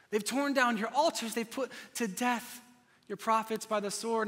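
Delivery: a milder, rising plea to God; desperate plea, toned down. Acted. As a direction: start calmer, build back up to anguish. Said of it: "And Elijah says again".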